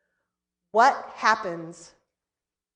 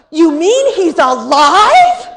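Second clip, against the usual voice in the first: loud, high, slow joyful cry with a laugh; overjoyed child shout; 7 semitones higher, 16 dB louder, slower than usual. Retold or imitated. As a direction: burst out in amazed delight. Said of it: "jumped up threw his arms".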